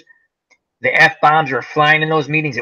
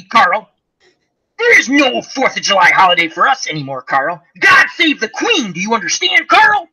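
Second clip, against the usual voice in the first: mock tirade, climbing higher as it builds; exasperated scolding; 6 semitones higher than usual. Retelling how they told off Carl about Fourth of July, in mounting exasperation. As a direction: lecture him in rising, indignant disbelief.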